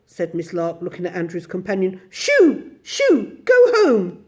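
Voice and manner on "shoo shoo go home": high, loud shooing calls; indignant, high-pitched shouting; crying out. A narrator's reading. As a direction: drive the dog off with sharp cries. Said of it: "she cried".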